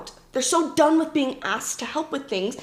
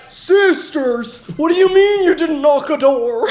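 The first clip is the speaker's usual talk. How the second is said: loud, hammed-up reprimand; mock scolding elders; 4 semitones higher, 8 dB louder, slower than usual. Elders telling off the sisters, mock outrage. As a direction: scold in comic disbelief.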